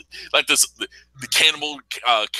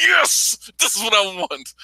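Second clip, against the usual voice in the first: gleeful yes; 7 semitones higher, slower than usual. Retold or imitated. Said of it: "and like".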